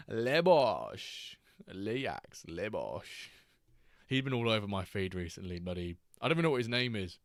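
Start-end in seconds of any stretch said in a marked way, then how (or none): 0.1-1.3 "Le Bosch" in a loud, laughing, hammed-up French accent with a long falling "Le"; laughing French-accent catchphrase; mock French accent. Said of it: "and he's like, "Le Bosch". 1.7-3.3 put-on light French-accent catchphrase; mock French accent. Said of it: "and he's like, "Le Bosch".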